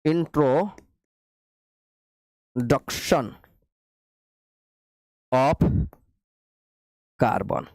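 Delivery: introduction of carbon dictated word by word, very drawn out; slow dictation. Shown as a demonstration. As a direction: spell it out slowly for note-taking. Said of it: "चलिए लिखिए आप लोग". (introduction of carbon बहुत धीरे, लिखवाते हुए)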